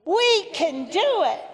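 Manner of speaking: high, rousing group cheer; enthusiastic. Shown as a demonstration. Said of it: "with enthusiasm".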